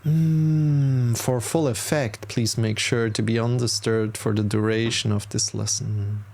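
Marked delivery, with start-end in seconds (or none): 0.0-1.2 long, steady hum; humming exhale. Shown as a demonstration. Demonstrating the exhale for listeners, long hum. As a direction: hum the breath out slowly.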